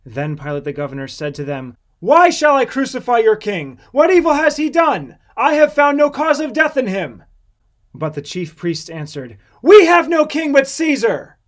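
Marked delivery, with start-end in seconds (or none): 2.0-7.2 loud, high, urgent questioning; governor's raised voice in exasperated defence; heated protest. A narrator's reading. Pilate protests to the crowd. 9.6-11.4 high, very loud defiant cry; priests' emphatic public shout; shouted retort. A narrator's reading. Chief priests shout back at Pilate.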